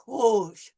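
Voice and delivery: rouge with the tongue pulled back; throaty r. Shown as a demonstration. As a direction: say rouge with a uvular r. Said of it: "we pull it back".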